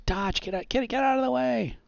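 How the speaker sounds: high, urgent mock yell; bystander's yell. Game master voicing someone warning others to dodge, mock yell. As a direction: call out a warning, pitched high. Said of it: "you hear somebody else yell".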